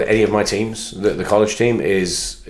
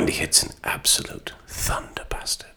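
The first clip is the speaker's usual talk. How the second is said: hushed whispered goal call, much softer; ASMR commentary whisper; 3 semitones higher, 7 dB quieter than usual. Pretend. Co-host joins the football ASMR joke, hushed whisper. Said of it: "football A S M R".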